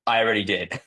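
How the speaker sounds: ordinary talk, nothing marked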